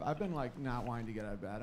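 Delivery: ordinary delivery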